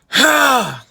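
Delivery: short, loud, high raspy "hah"; diaphragm scream burst. Shown as a demonstration. Coach showing diaphragm support, short screamed exhale. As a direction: punch the breath out from the belly. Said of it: "use your diaphragm".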